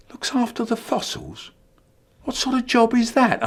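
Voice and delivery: incredulous high question. Acted. Incredulous question about brother's job.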